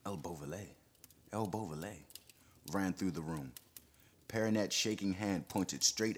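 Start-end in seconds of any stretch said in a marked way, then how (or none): 0.0-2.0 hushed, startled murmur; startled whisper. Acted. Room murmuring the feared name, hushed and startled. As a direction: breathe the name in alarm. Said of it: "The startled whisper".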